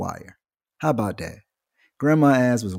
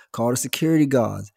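ordinary delivery

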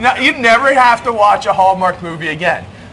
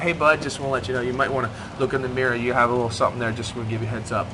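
soft, low and gentle; gentle kind way; 6 semitones lower, 8 dB quieter, much faster than usual. Pretend. Dad gently tipping off his son about a zit, soft and kind. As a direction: let him down easy, softly. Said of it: "Or I could say".

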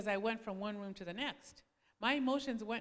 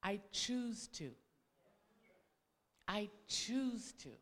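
lower, softer, slow and steady; calm husband; 4 semitones lower, 7 dB quieter, slower than usual. Retold or imitated. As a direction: answer her with quiet certainty. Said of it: "he would always tell me".

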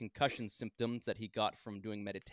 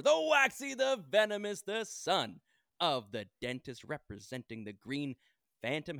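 announcer intro; 3 semitones higher than usual. Acted. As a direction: introduce him like a wrestler. Hype introduction of guest.

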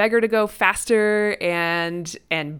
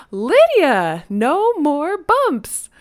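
higher, louder, slow singsong; playful shout; 7 semitones higher, 6 dB louder, much slower than usual. Retold or imitated. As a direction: draw out the catchphrase. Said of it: "I again shouted".

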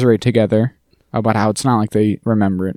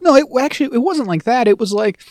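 high, put-on backpedalling; Dee; 13 semitones higher than usual. Co-host imitating Dee trying to explain it away, high protest. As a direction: lighter mock protest, pitched well up. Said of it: "D is trying to be like".

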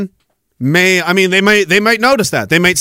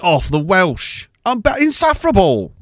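put-on haughty English accent, rising high on the scorn; exaggerated English disdain; 3 semitones higher than usual. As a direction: sneer in a mock English accent. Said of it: "other English people are like".

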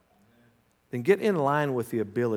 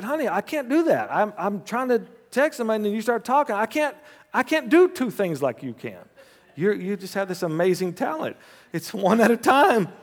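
quoted husband; 8 semitones higher, 4 dB louder than usual. Retold or imitated. Husband explaining to wife, quoted.

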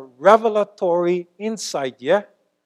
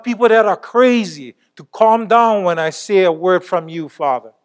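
fervent preaching prayer; 3 semitones higher, 7 dB louder than usual. Acted. Preacher crying out to God before the church, fervent and loud. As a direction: pour it out to the Lord at full force.

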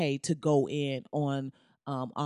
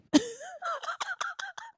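shrieking high laugh; 3 dB quieter than usual. Real, not acted. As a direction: burst into a squealing laugh. Laughs at own joke.

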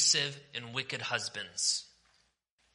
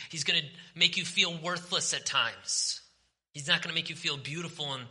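usual speaking voice

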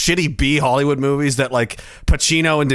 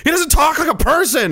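baffled; 11 semitones higher than usual. Retold or imitated. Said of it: "I was like".

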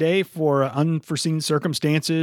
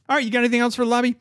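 rushed; 10 semitones higher, much faster than usual. Real, not acted. Host rushing the segment.

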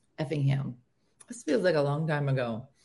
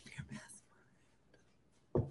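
breathless whispered reply; touched whisper; 6 semitones higher, 15 dB quieter, slower than usual. Retold or imitated. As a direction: whisper it as if moved to tears.

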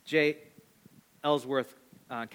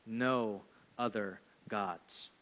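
much softer, slow, lower; hushed solemn reading; 8 semitones lower, 9 dB quieter, much slower than usual. Real, not acted. Pastor reading the commandment, slow and hushed. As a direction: let the words sink in quietly. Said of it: "hushed emphatic reading".

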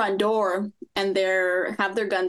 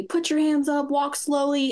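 retold yelling; 6 semitones higher than usual. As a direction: mimic officers yelling commands, raised in pitch. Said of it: "they're yelling at me".

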